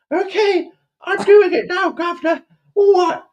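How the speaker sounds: very high, loud Cockney squeal; mock Cockney urchin. Urchin answering his governor while polishing, squealed Cockney. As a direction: squeak back eagerly as a Cockney servant. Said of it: "I heard your Cockney accent".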